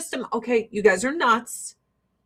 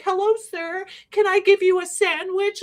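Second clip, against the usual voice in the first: mock sweet girl; 7 semitones higher than usual. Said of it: "she was like".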